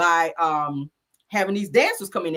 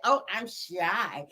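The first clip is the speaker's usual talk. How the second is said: drawn-out, softer fake coyness; mock shy; 8 dB quieter, slower than usual. Mocking Trish playing shy before the dancers, slow coy imitation. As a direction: mimic someone playing shy, very slowly. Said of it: "She be trying to play that".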